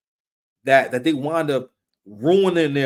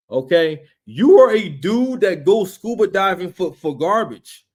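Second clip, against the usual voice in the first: normal talking voice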